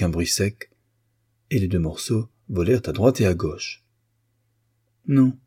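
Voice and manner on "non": higher, louder sudden no; sharp exclamation alone in the room; almost aloud. A narrator's reading. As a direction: burst out to herself. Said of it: "presque à haute voix".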